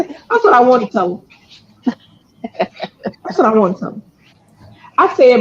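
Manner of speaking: ordinary talk, nothing marked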